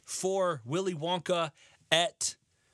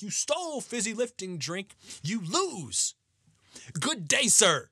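Wonka rant; 4 semitones higher than usual. Retold or imitated. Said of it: "one of the best rants".

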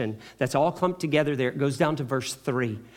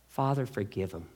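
soft plea; 5 semitones lower, 8 dB quieter than usual. Acted. Jesus voiced softly after pause.

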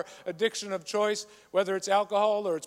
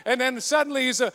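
ordinary talk, nothing marked

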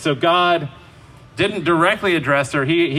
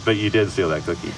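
low, soft, matter-of-fact parent reply; calm knowing parent; 8 semitones lower, 3 dB quieter than usual. Shown as a demonstration. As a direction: gently state it as plain fact. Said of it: "my favorite parenting lines".

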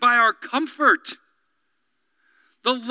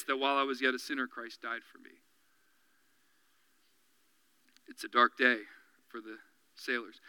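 soft, low sermon voice; quiet preaching; 8 semitones lower, 5 dB quieter than usual. Acted. Pastor quietly answering the enemy's lie. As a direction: draw the congregation in gently.

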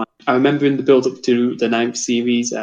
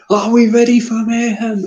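mock presenter scream; 10 semitones higher than usual. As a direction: scream it like a TV host. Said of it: "You can't have him screaming".

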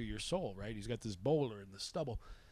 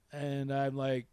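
ordinary delivery

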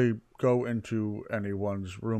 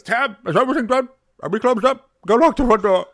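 much higher and louder call from another room; 11 semitones higher, 10 dB louder than usual. Acted. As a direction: yell orders across the bar to close up. Bartender calls out from the back room.

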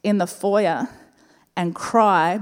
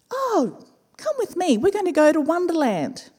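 sing-song helper voice, much higher; kindly lady inviting; 8 semitones higher than usual. Retold or imitated. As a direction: coax a crying mother along, pitched way up. Voices kind lady inviting her along.

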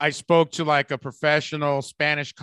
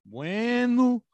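put-on mountain Spanish accent; 5 semitones higher than usual. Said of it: "if you're from the mountains".

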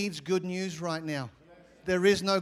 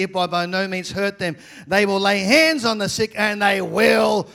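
loud, raised scripture declamation; full-force preaching; 5 semitones higher, 9 dB louder than usual. Acted. Preacher reading the gospel of Mark to the congregation, full-force preaching.

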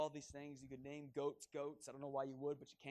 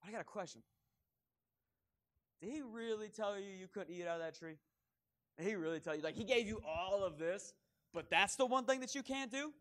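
higher, taunting serpent voice; sly serpent; 4 semitones higher than usual. Retold or imitated. Serpent questioning Eve about the tree, higher taunting voice. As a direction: plant the doubt, sneering. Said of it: "and he goes".

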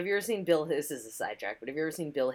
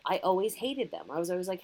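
normal talking voice

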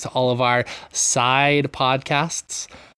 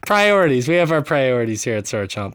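ordinary talk, nothing marked

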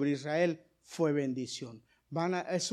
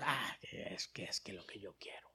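much lower and softer, whispered excuse; mocking whine; 10 semitones lower, 16 dB quieter than usual. Preacher mimics stubborn believers, whispered whiny excuse.

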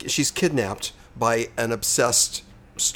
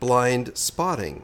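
BLIND SPOTTING drawn out very slowly; careful checking; much slower than usual. Real, not acted. Host double-checking the film title, "Blind Spotting" slow and deliberate. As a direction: say "Blind Spotting" slowly, testing each word. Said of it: "checking to make sure that you did say it right".

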